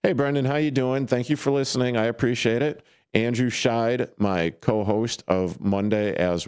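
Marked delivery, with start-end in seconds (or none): none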